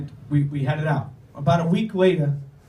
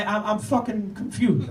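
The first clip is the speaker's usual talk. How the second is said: usual speaking voice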